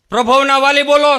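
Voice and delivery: loud, high phone call; teacher loudly calling a student's father. Retold or imitated. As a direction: shout down the phone line.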